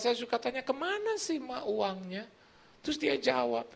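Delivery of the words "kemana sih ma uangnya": mildly exasperated. Retold or imitated. Son asking mother where the money went, mildly exasperated. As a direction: ask it with a touch of irritation. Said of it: "suka agak jengkel".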